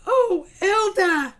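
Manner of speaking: high, slow motherly sigh; mother elephant soothes her child, high and slow; fond mom. Acted. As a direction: coo over her little one.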